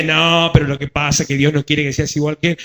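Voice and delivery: low, sly talking serpent; serpent's voice. Acted. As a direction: coax her like a low sly snake. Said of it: "Y la serpiente".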